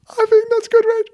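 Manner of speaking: very high, loud retold squeal; ecstatic. Actor reacts to director's praise, hammed-up high ecstasy. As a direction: squeal with overjoyed disbelief, hammed up. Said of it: "I was just ecstatic".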